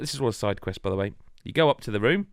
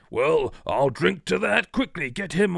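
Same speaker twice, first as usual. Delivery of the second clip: hearty toast; 6 semitones higher, 3 dB louder, slower than usual. Retold or imitated. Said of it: "they essentially go".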